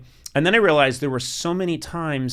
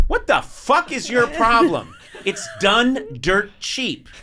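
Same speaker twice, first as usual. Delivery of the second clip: higher, louder mock scold as the brother; 10 semitones higher, 5 dB louder, slower than usual. Brother Luke scolding, as an exasperated older brother. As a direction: snap at your sibling's wrong lyric. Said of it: "my brother Luke was like".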